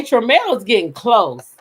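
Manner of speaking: usual speaking voice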